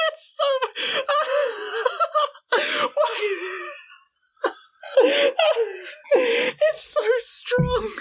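high, squeaky words through laughter; helpless laughing. Real, not acted. Player reading how strong the dragon is, helpless laughing. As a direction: barely get the words out for laughing. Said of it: "I can't stop laughing now".

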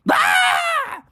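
high scared scream; shout. A narrator's reading. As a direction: scream in fright. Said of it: "they both shouted".

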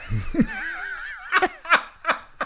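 shrieking burst of laughter; a high helpless cackle; high squealing laugh. Real, not acted.

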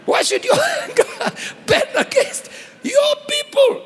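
very high, laughing challenge; laughing retelling. Retold or imitated. Preacher replays Moses daring to question God, laughing. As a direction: break up laughing while quoting the protest.

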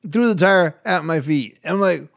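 ordinary talk

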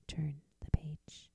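soft whispered page cue. Real, not acted. Reader whispers to the listener to turn the page.

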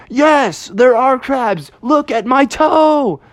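high-pitched pained exasperation; exasperated bear. Acted. Pinched bear answering Techno Bear, high exasperated voice. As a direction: insist in a high, put-upon voice.

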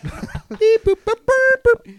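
very high beeping robot; squeaky robot beeps, laughing. Acted.

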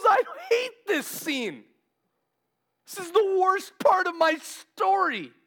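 high, drawn-out anguished outcry; emotional. Retold or imitated. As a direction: act out distress, loud and high. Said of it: "if he's getting emotional".

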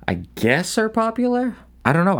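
high, mocking put-on voice; high sarcastic. Real, not acted. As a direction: squeak it out mockingly. Dismissing country songs.

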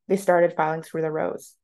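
plain reading voice

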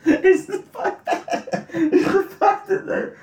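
high, slow words squeezed through laughter; giggling too hard to get it out; laughing. Real, not acted.

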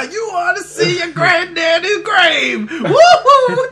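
high cackling hollered prospector voice; old prospector. Retold or imitated. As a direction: holler it like a crazed old miner. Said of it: "like, the old prospector".

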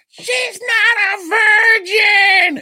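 hammed-up high, loud shriek; witch's shriek. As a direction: scream it out like an outraged movie witch. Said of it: "this all natural witch walks up ... goes".

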